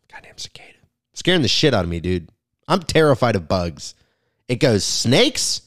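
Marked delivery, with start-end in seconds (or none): 0.1-0.7 very soft whispered grumble; muttered curse. Real, not acted. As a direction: curse it under your breath.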